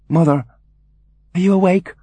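gentle, high-pitched question to mother; careful, lifted inquiry; tentative calling. Acted. Son calling gently at door.

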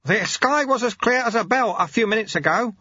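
worried apprentice; alarmed young monk. Acted. Lobsang voiced, alarmed.

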